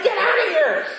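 high, laughing mock yell; retold shout. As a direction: yell it as a comic reenactment. Kicked out while volunteering.

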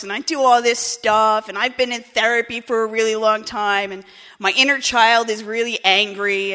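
loud, slow, dismissive lecture; defiant brat. Retold or imitated. Newcomer loudly rejecting the old-timer's advice, bratty. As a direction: brush him off with loud teenage scorn.

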